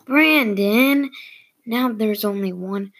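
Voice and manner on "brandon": raised, drawn-out name call; yelling. A narrator's reading. Whole team yelling at the player who kicked, called out. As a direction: call the name out high, stretched long. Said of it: "everybody yells".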